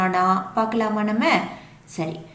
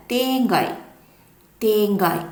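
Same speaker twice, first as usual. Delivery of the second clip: normal talking voice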